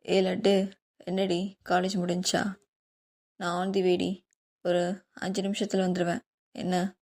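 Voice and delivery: put-on easygoing young husband calling his wife; husband on the phone. A narrator's reading. Varun voiced on phone. (லேசாகத் தாழ்ந்த குரலில் கணவன்)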